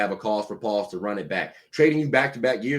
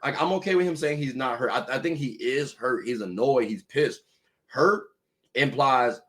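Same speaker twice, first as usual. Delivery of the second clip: ordinary talk, nothing marked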